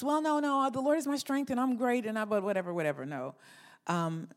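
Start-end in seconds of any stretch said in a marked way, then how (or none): 0.0-3.1 dismissive brush-off. Retold or imitated. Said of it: "Don't just".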